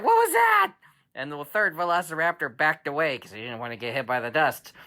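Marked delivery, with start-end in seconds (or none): 0.0-0.7 high, loud alarmed question; startled raptor. Acted. Raptors startled, voiced loud and high by dad. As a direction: jump at the noise, high and loud.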